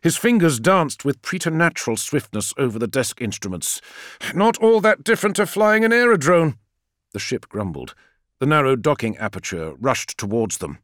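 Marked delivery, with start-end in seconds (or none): 4.3-6.6 high, loud, strained bravado; shouting under pressure. A narrator's reading. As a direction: call it out mid-manoeuvre. Pilot calls out during frantic flight.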